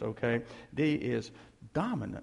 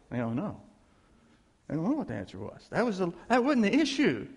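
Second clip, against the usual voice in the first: ordinary talk, nothing marked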